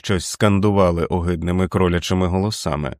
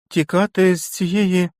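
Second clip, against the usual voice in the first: put-on incredulous child; 11 semitones higher, slower than usual. Feverish boy incredulous.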